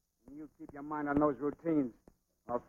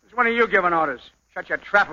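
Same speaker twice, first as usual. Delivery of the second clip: loud, raised, aggressive order; angry; 4 semitones higher, 8 dB louder than usual. Acted. Rival telling a boxer to shut up, angry and loud. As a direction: shut him up angrily, voice pushed up.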